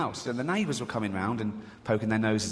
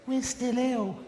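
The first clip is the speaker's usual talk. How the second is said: pleading charity voice; 10 semitones higher, slower than usual. Retold or imitated.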